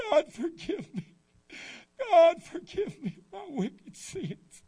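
high, loud, very slow crying plea; mock sobbing prayer. Teen weeping to God over his sins, slow sobbing plea. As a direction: drag out each word through sobs.

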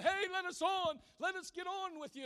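high, loud desperate call; crying out. Acted. Mother and child calling loudly to the lifeboats. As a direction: shout up to the boats, begging. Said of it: "they cried out".

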